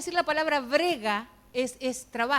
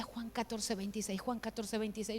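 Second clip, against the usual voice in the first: low, soft repeated murmur; quiet inner prompting; 4 semitones lower, 9 dB quieter than usual. Retold or imitated. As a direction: repeat it softly, lower. Voices the Holy Spirit's repeated prompting.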